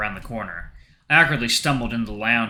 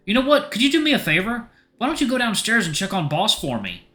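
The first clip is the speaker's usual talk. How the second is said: high, coaxing character voice; favour-asking boyfriend; 10 semitones higher than usual. Acted. Sissel asks Adrian to check on Boss, high and coaxing. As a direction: ask sweetly, pitched well up.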